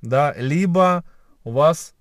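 usual speaking voice

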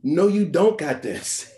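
doubting inner voice. Retold or imitated. Self-doubt talking back to the nervous doctor, slow and deflating. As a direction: let the inner voice crush his confidence slowly. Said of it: "I heard a voice say back to me".

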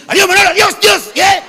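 high, loud rapid-fire hail; hasty Madrid greeting. Retold or imitated. Madrileño greeting a friend while waiting for taxi, hurried shout. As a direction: call it out high and loud in passing. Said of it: "¿Y cómo te saludan".

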